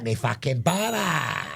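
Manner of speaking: slow, smug and unbothered; smug customer. Pretend. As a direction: drag it out, totally cool.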